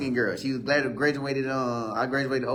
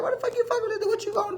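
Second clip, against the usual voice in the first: much higher, laughing disbelief at her; incredulous high squeal; much faster than usual. Retold or imitated. Teller to girl baiting a mental man, laughing high disbelief. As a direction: squeal it like you can't believe her nerve. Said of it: "I started telling, like".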